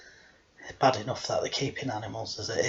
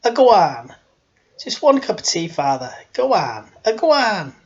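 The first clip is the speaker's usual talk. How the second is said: high, loud, insistent housekeeper; Missus Doyle; 6 semitones higher, 10 dB louder than usual. Acted. Housekeeper pressing tea on the Father, loud insistent nagging. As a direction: badger him, each urging swooping down from high. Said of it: "No, thank you, Missus Doyle".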